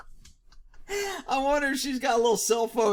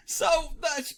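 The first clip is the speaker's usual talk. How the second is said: ordinary delivery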